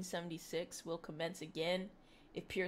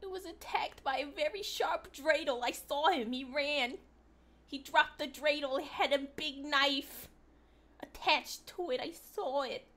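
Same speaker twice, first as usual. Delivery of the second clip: higher, louder breathless witness; mock eyewitness; 6 semitones higher, 4 dB louder than usual. Streamer mocking the tire story as a frantic eyewitness. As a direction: play a frantic bystander.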